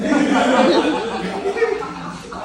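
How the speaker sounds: hearty laugh; big real laugh; loud laugh. Real, not acted. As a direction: crack up. Laughs at own story.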